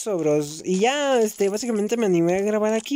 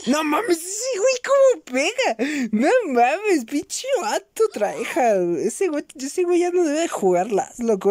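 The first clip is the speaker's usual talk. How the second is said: high-pitched disbelief at the enemy; exasperated gamer outburst; 9 semitones higher than usual. Real, not acted. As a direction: rant at an opponent who outplays you. Gamer reacting to an enemy's skill. (exasperación aguda de jugador)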